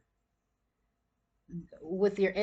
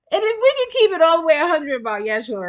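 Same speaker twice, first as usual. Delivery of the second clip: louder, higher and faster, fired up; excited; 8 semitones higher, 10 dB louder, faster than usual. Real, not acted. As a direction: get worked up as you say it. Building an excited point about Yeshua.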